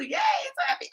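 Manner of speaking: very high, delighted squeal; squealed yay. Real, not acted. Cheering a returning chat viewer, high squeal. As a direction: squeal it with joy.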